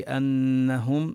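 أنهم with the doubled nun held long and nasal; أنهم held slowly with clear ghunna; nasal ghunna shown. Shown as a demonstration. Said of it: "ونبين الغنة في".

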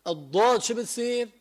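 ordinary talk, nothing marked

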